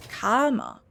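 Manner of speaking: Karma spelled out slowly, KAR-ma, each syllable clear. Shown as a demonstration. Correcting a listener who heard caramel, slow careful Karma. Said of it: "I didn't say caramel".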